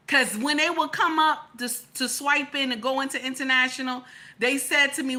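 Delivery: normal talking voice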